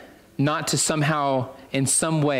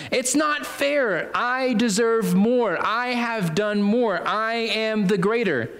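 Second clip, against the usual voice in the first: high, slow mock protest; indignant self-entitlement; 5 semitones higher, slower than usual. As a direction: stretch each claim out, pitched high. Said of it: "they would obviously say".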